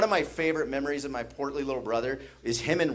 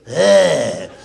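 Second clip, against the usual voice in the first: wheezy honk; 5 semitones higher, 8 dB louder than usual. Retold or imitated. Imitating brother's sound.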